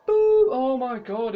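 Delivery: loud exasperated boo; frustrated boo. Real, not acted. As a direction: groan at bad luck. Bad luck again.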